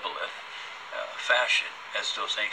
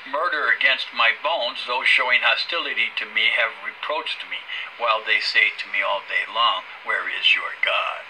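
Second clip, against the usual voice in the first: loud, self-righteous preaching; preachy voice; 7 dB louder than usual. Acted. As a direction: preach it with full conviction. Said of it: "He's off on his preachy voice again".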